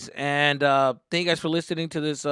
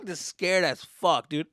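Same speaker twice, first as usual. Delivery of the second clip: ordinary delivery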